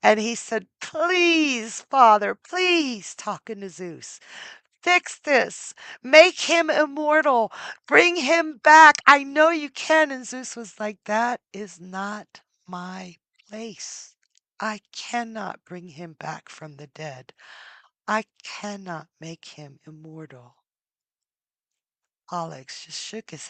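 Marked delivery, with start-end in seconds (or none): 0.8-10.1 high, loud pleading to his father; desperate begging. Acted. Pollux begs father Zeus. 11.1-20.6 solemn father god saying no; grave refusal. Acted.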